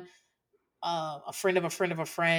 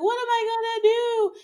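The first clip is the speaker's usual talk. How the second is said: high, loud, hammed-up despair; mock wailing widow; 7 dB louder than usual. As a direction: overact the grieving wife. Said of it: "she's gonna be like".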